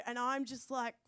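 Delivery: ordinary talk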